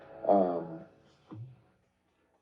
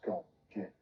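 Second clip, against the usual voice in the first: retold hushed order; 2 semitones lower, 14 dB quieter than usual. Reggie privately telling him to go, retold hushed. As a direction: imitate a quiet aside.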